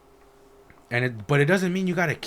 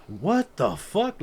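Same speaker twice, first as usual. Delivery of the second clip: baffled disbelief; 8 semitones higher, much slower than usual. Retold or imitated. Retold confusion at vague instructions.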